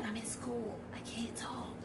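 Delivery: mock hushed whisper of a kid in class. Imitating her daughter brushing her off, in a whisper. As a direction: whisper it like you can't be overheard. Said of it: "that's what Cadence gives me".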